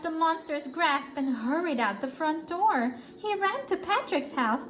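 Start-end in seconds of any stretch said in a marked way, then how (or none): none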